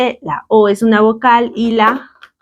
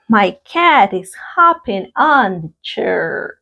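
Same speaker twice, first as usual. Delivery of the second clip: slow, stretched-out example for learners; measured teacher's repetition; slow model sentence; slower than usual. Shown as a demonstration. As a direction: read it out slowly for the class.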